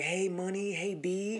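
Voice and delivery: high, soft, put-on buddy talk; condescending fake slang. Dog owner buddying up to the homeowner, condescending and high. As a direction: patronize him with slangy pet names, lightly and high. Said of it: "Doing that accentual".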